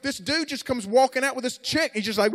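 high, loud sermon storytelling; heated preaching. Acted. Preacher describes the weeping crowd to young listeners, heated preaching. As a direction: build the scene with urgency.

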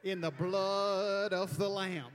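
hammed-up Alabama accent. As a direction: stretch "washed" into an Alabama "warshed". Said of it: "In Alabama, we would say".

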